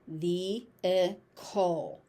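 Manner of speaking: "Vehicle" slow as vee, ih, cul; syllable by syllable. Shown as a demonstration. Teacher breaking the word down for learners, syllable by syllable. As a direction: pause between each syllable of "Vehicle".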